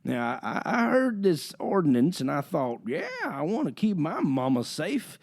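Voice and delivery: slow high Texas council drawl; southern drawl. Retold or imitated. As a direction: drawl it like a small-town Texan. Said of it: "southern drawl voices".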